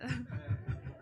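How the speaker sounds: a quick real laugh at the jab; short laugh. Real, not acted. Host laughing at the donor's teasing, short laugh. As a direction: laugh it off briefly.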